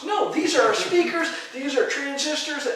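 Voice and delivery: high, loud, laughing mock desperation; frantic protest. Time traveler explaining speakers to witch-burners, frantic mock protest.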